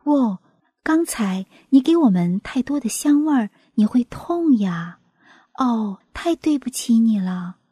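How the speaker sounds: usual speaking voice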